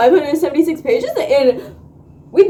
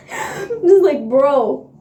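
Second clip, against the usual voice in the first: laughing through tears; slower than usual. Real, not acted. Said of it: "I've been getting emotional".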